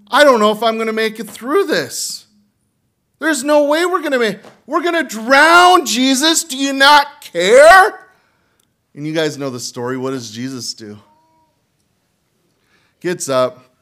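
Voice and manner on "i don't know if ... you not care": much higher, louder, hammed-up panic; panicked disciples. Preacher voicing disciples in the storm, hammed-up panic. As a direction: cry out like men sure the boat is sinking. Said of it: "and they're freaking out".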